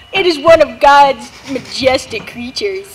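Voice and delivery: documentary narration. Acted.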